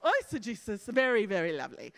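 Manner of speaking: hammed-up British accent, quick praise; put-on British accent. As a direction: approve in a mock-posh British accent. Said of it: "Can you say it with an accent?".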